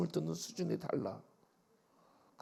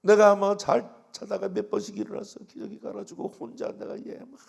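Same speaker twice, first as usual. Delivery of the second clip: normal talking voice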